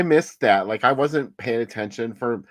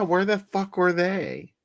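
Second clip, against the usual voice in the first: exasperated disbelief; 9 semitones higher, slower than usual. Real, not acted. Complaining about missing skeleton warriors.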